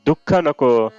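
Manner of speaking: usual speaking voice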